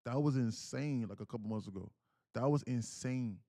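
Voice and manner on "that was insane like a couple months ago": ordinary talk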